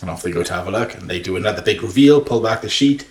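ordinary talk, nothing marked

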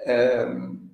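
ordinary delivery

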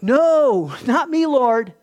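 loud, acted-out protest; indignant mock protest; emphatic denial. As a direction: refuse it forcefully, with a loud drawn-out start. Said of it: "He's emphatic".

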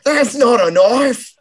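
put-on high, slow Australian accent line; a hammed-up Australian accent; mock Crocodile Dundee. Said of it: "Crocodile Dundee".

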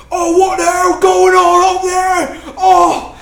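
stretched-out monster yell of alarm; confused orc shouting. Acted. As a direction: bellow it like a bewildered orc. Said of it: "you can hear orcs saying".

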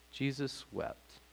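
slow quiet reverent reading; slow hushed reading. Shown as a demonstration. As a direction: hush it and draw it out. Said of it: "Very profound".

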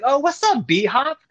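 high-pitched hail to a celebrity; excited greeting. Retold or imitated. Dealer greeting a boxer he recognises, excited. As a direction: act out delighted recognition, voice jumping up.

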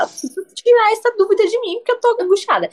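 anguished. Retold or imitated. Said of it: "eu falei pro Vitor".

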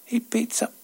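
high, startled question; shocked echo. Acted. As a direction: repeat it back in alarm. Narrator shocked by reply.

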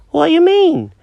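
high-pitched confused cat; squeaky cartoon cat, lost and puzzled. Jenkins voice.

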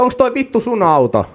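high-pitched mock shout; retold shout. Asking a construction man if it's his car, mock shout. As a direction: imitate yourself calling out an angry question, pitch up. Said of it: "Jep, ja mä huudan".

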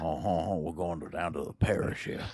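hushed mock French-Louisiana accent; put-on parish accent. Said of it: "I like that accent".

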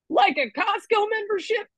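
high-pitched comic disbelief; mock incredulous. Comic reacting to the gold star label, mock incredulous. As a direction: question it in a squeaky-high, baffled joke voice.

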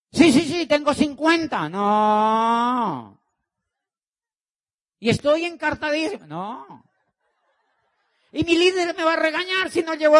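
high, loud, rushed pleading; pleading desperate seller. Pretend. Mocking a desperate seller who fears the boss, loud and high. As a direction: beg like a salesperson behind on quota.

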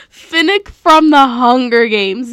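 loud, slow laughing correction; laugh, then belt out the right title; amused and emphatic after a blunder; laughing self-correction. Real, not acted. Laughing while correcting own blunder.

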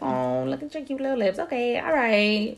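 lightly voiced tender making up; softened, cooing. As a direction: coo the compliments to calm things down. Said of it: "you'd be like".

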